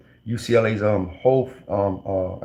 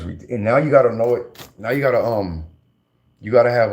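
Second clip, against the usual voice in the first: normal talking voice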